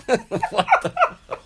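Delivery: laughing, high and slow; spluttering through laughter. Real, not acted. Laughing at absurd turn.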